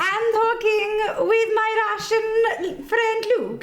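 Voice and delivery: high, slow hammed-up Borat accent, laughing; Borat-esque accent. Host introducing his Russian friend Luke in a silly Borat accent. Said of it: "Very Borat-esque".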